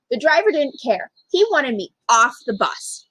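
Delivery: usual speaking voice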